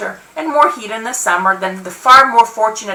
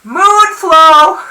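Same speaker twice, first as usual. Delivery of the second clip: loud, high, stretched call; 7 semitones higher, 7 dB louder than usual. A narrator's reading. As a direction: holler it up to the roof. Shouted exchange with neighbor continues.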